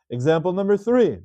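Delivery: ordinary delivery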